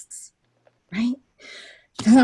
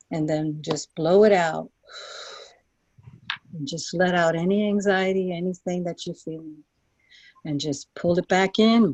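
calm them down into the journey; 3 semitones lower than usual. Acted. Leads a guided visualization.

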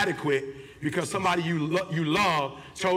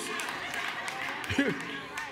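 big laugh; 7 semitones higher, 7 dB quieter than usual. Real, not acted. Laughs at own joke.

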